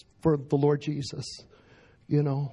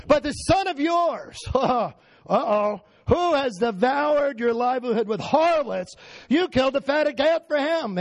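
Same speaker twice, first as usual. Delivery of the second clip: put-on upset older son; 7 semitones higher than usual. A narrator's reading. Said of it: "So he's upset".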